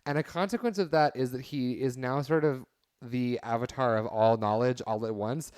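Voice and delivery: ordinary talk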